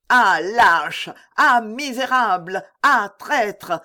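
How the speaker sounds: shouted insults. A narrator's reading. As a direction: hurl each insult separately at the deserters. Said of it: "criait De Winter". (invectives criées, fortes)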